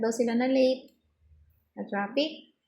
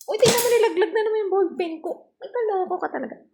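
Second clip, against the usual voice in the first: high, fast teasing scold at the dog; playful scolding; 11 semitones higher, 6 dB louder, faster than usual. Real, not acted. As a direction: tease the dog, quick and high-pitched. (mapaglarong pagsaway, mataas at mabilis)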